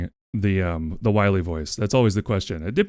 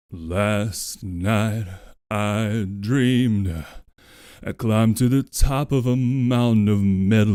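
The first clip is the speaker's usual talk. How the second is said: Doctor Light voice; slower than usual. Acted. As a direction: sing it as the sorrowful inventor. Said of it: "the Doctor Light voice".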